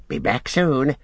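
strange, high character voice; Gollum's creature voice. A narrator's reading. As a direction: toss it off as an eerie little creature. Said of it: "said Gollum".

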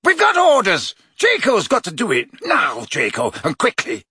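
shouted commands; angry order. A narrator's reading. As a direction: bark orders at the boy. Brutal Death Eater commanding.

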